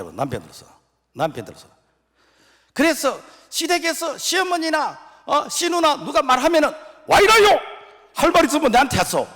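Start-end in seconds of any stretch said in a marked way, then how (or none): 2.7-6.7 high, loud preaching cry; preaching climax. Real, not acted. 7.1-9.2 very loud, high Gyeongsang outburst; defiant shout. Shown as a demonstration. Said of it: "요렇게 말해야 돼".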